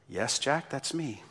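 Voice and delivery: low, soft, unruffled reply; calm voice of God. Acted. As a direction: answer quietly, in complete calm. God answers Jack calmly.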